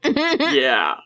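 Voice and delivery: laughing through the word. Real, not acted. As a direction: laugh as you say it. Laughing at the meme.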